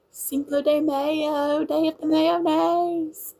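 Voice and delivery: high, drawn-out Louisiana country twang; thick Louisiana country accent. Retold or imitated. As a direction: imitate local kids with a thick, slow country accent. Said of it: "all of the people's accent around here".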